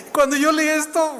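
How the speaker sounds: squeaky high laugh through the words; laughing helplessly while remembering the comedians. Real, not acted. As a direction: crack up mid-sentence. Laughing fit while recalling.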